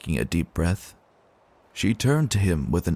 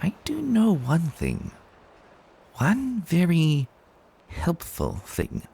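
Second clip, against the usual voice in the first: devilish tease; 8 semitones higher, slower than usual. Acted. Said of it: "devilish smirk".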